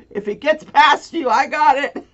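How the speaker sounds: high, loud battle-ready call; mock-heroic shout armed with a broom; ready to swing. Armed with broom, bracing for raccoon.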